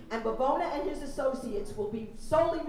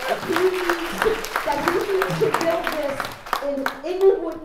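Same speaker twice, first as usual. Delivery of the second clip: angry demand; 6 semitones higher, 9 dB louder than usual. Real, not acted. Tells the developer off at hearing.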